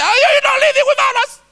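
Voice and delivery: put-on high, shrieking rush to wake the kids; excited wife. Wife hurrying the kids to church in a high shriek.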